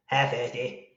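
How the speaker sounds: failed cartoon voice attempt; Cartman impression. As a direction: have a poor second go at the cartoon voice. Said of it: "even worse".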